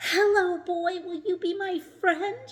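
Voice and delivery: high, loud and overjoyed; joyful shout. A narrator's reading. Rock shouting joyfully to the small boy, high and loud. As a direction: shout with delight. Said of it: "shouted Rock with joy".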